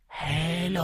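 high, soft, otherworldly greeting; a spooky ghost voice teasing him; eerie ghost hello. Acted. Ghost speaks foreign word.

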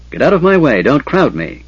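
gruff irritated grumble, loud start; grumbling. Acted. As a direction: grumble at the crowd pushing in. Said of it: "Caramon grumbled".

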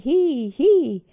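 high, loud cowboy yell; full-lung shout. A narrator's reading. Cowboy driving the cattle, shouting at full lungs. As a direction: holler it out to the herd. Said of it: "urlava a pieni polmoni".

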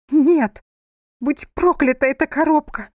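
high, loud cursing; angry outburst. A narrator's reading. Frightened wife curses the box to husband, angry outburst. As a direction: burst out and curse it.

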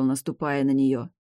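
ordinary delivery

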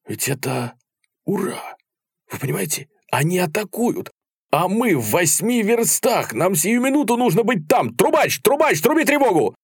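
high frantic shouted orders by the end; urgent alarm. A narrator's reading. Officer realising they are attacking calls the trumpeter, mounting alarm.

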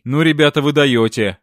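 ordinary delivery

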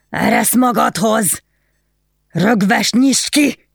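put-on slow, high drunken yelling; drunken shouting. A narrator's reading. Drunk husband shouts at wife's locked door. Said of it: "kiabálta".

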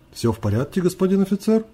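wary question rising high at the end; guarded, uneasy checking. A narrator's reading. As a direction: ask with mild alarm, pitch climbing. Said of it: "насторожился мужчина".

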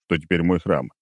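usual speaking voice

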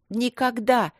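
high defiant shout. A narrator's reading. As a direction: shout the refusal. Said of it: "крикнул Майкл". (решительный крик)

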